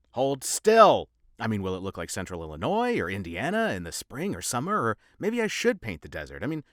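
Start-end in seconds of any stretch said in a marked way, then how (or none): 0.1-1.1 sharp high shout; shouted order. Acted. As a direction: bark the order loudly. Shouting at patient.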